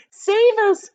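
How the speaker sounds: high, loud desperate plea; panicked cry. Acted. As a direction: beg to be saved in terror. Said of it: "cried Miss Spider".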